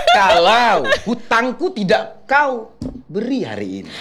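slow, high, laughing threat; acted anger. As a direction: threaten a debtor, half laughing. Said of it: "acting marah sama saya". (marah menagih utang sambil tertawa)

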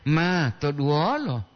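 long "ma", then "to duolo"; "maa... to duolo", drawn out; clarified intonation. Shown as a demonstration. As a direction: set "ma" apart before "to duolo". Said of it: "Makanya intonasi dia itu diperjelas".

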